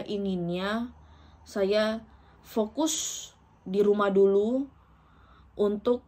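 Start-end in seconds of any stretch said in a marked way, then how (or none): none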